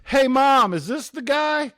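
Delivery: high, loud call to her mother; daughter calling out. Retold or imitated. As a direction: shout back into the kitchen, eager. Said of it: "she goes".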